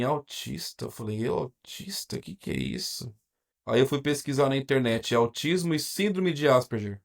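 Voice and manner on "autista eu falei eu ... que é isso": hushed disbelief. Retold or imitated. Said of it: "e eu pensei assim".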